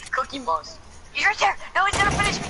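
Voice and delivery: high panicked game yelps. Real, not acted. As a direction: panic as he closes in. Panicked in the firefight.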